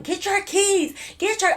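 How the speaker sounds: high yell to grab the keys. Retold or imitated. Yelling to her to grab the car keys, urgent high call. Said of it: "I'm just yelling for her".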